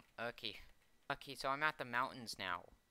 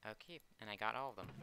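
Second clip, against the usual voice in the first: usual speaking voice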